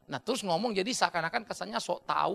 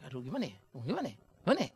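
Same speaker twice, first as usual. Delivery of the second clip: soft, hesitant mock dithering; indecisive father; 5 semitones lower, 4 dB quieter, much faster than usual. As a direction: mutter like a father who can't decide. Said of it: "Selalu ayah". (ayah peragu yang bingung, pelan)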